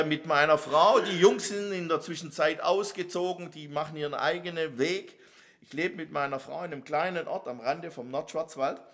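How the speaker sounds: ordinary delivery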